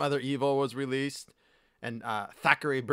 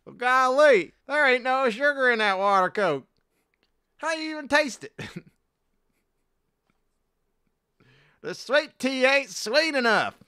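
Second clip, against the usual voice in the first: mock Southern accent; 13 semitones higher, 9 dB louder than usual. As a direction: ham up a Southern yokel's shock.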